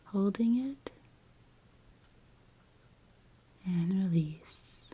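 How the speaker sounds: measured, soothing guide with long pauses; guided meditation. Acted. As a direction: gently lead the listener into relaxation, phrase by phrase.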